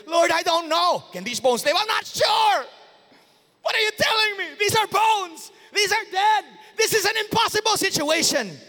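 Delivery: high, loud pleading protest; doubting Ezekiel. Acted. Ezekiel protesting to the Lord, loud and pleading. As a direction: cry out in disbelief to the Lord.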